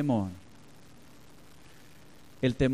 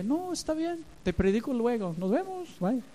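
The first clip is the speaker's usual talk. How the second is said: nervous retreat; 9 semitones higher, 4 dB quieter than usual. Retold or imitated. Backing away from man with pistol.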